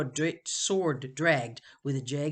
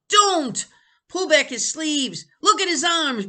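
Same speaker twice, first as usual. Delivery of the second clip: higher, louder triumphant crowing; crowed; 14 semitones higher, 11 dB louder than usual. Acted. Benny crowing to the others about the arms. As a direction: crow it to the room. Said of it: "Benny crowed".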